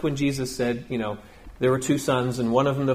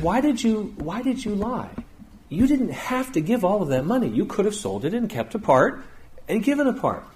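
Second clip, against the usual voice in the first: higher-pitched stern challenge; Peter confronting; 7 semitones higher than usual. Retold or imitated. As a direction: call them out before everyone, a touch stern. Peter confronting liars before church.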